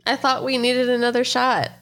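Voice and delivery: ordinary talk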